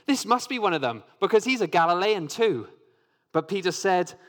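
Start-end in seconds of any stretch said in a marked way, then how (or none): 0.1-2.7 higher and louder, pressing the point; insistent. A narrator's reading. Bystander accusing Peter, insistent. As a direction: insist he's one of them. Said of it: "someone else insisted".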